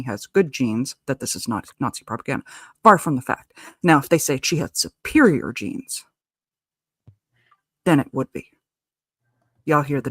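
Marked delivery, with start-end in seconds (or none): 4.0-6.0 SUPERIOR stretched slowly, leaning on the middle syllable; stress on superior. Shown as a demonstration. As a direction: hit the word superior hard and drawn out. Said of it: "emphasis on the word superior".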